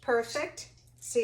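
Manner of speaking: usual speaking voice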